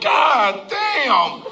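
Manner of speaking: retold shout of disbelief with a laugh in it; shocked exclamation. Man on a street full of women exclaims in laughing shock. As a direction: blurt it out, floored by what you see. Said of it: "I said".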